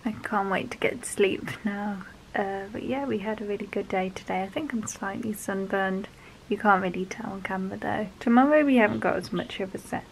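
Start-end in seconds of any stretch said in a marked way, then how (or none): 0.0-8.1 soft late-night whisper; whisper, too tired to speak up. Real, not acted.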